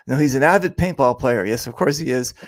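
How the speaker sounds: ordinary talk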